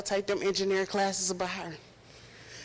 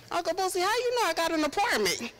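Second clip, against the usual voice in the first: surprised; 8 semitones higher than usual. Retold or imitated. Retelling her surprised reply.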